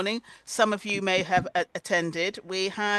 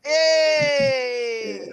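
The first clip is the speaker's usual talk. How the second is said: cheering yay; 2 semitones higher, 4 dB louder than usual. Acted. Laughter yoga cheer.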